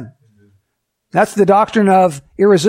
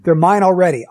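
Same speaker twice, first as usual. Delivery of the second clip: usual speaking voice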